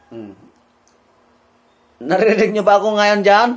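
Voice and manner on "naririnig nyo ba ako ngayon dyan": loud, alive voice. Shown as a demonstration. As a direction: project it out to the back row, higher and brighter. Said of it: "Malakas ang boses".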